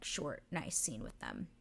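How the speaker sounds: ordinary delivery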